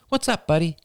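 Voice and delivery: high, forced cheerful check-in; forced sing-song. A narrator's reading. Mother coaxing her sick son, forced sing-song. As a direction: keep the cheer pasted on. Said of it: "in a slightly forced sing-song voice".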